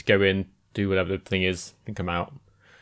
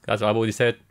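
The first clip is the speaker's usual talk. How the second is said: ordinary talk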